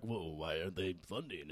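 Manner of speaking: low, soft gruff Batman growl; lightly voiced Bruce Wayne, low and soft. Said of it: "that was my Bruce Wayne voice".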